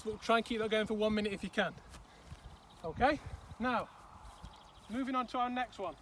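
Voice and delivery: normal talking voice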